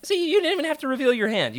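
ordinary delivery

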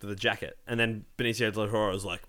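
usual speaking voice

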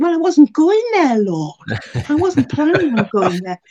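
playfully arguing back, pitch jumping up; mock protest. Protesting God's directions to cross over, mock indignant.

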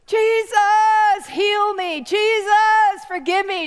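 high, loud wailing prayer; acted desperate cry for mercy; imitated crying out. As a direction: wail the name, then plead. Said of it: "She's crying out".

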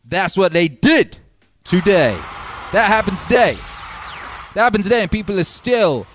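loud, high ranting announcement; hype rant. Acted. Ranting hard at the audience, loud and high. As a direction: hype it up like a wild show opener.

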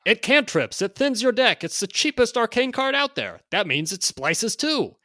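loud, high hard-sell pitch; carnival barker. Acted. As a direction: hype it like a fairground barker. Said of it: "read this in sort of a carnival barker".

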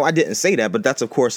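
ordinary talk, nothing marked

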